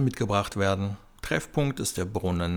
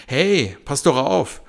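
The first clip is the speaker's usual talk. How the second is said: loud, higher sharp yell; shouting; 6 semitones higher, 8 dB louder, slower than usual. Acted. Tabata yells at a child who nearly ran her over, loud shout. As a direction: yell a warning after a running kid. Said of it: "schrie sie auf einmal einem Kind hinterher".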